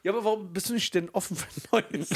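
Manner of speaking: normal talking voice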